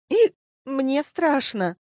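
high, slow, hiccup-broken admission; scared. Acted. Tipsy girl fears falling asleep in street, scared. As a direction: whine it out fearfully.

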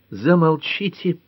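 high, slow sharp cut-off; cutting off. A narrator's reading. Nora cuts Ellery off, high sharp command. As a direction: silence him, pitched high. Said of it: "оборвала его Нора".

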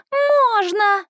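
put-on high, slow little girl asking; toddler voice. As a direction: plead like a tiny child. Toddler Minnie asks again.

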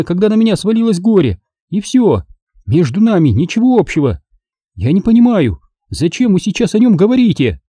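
high, rushed protesting woman; Margo, agitated and high. A narrator's reading. Margo protesting to Engel about Brock. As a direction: defend herself, getting flustered.

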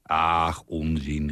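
ordinary delivery, nothing marked

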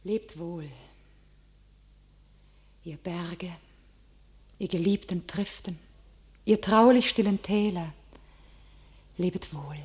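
soft, near-whispered goodbye to the hills; hushed farewell. Acted. Johanna bids the hills farewell, hushed and whispering. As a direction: let the farewell fall to a whisper.

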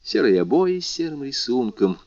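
ordinary delivery

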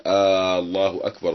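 stretched questioning آه الله أكبر, long opening vowel; آه drawn out as a question before الله أكبر; drawn-out questioning aah. Shown as a demonstration. Teacher demos the wrong drawn-out آه الله أكبر. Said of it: "ويقصدها استفهاما كفر".

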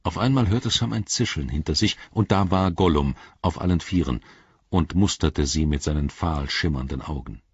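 plain reading voice

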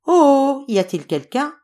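high, loud opening "oh", questioning; a light storybook call into an empty house. A narrator's reading. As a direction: call out for anyone home. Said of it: "cria-t-elle".

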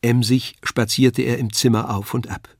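ordinary delivery

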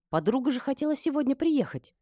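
high-pitched startled exclamation; sudden realisation. A narrator's reading. Mistress remembers her friend's visit, startled. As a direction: exclaim as it dawns.

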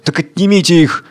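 sharp order in another timbre; curt command. Acted. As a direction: snap the order. Tsar commands. (резкий приказ)

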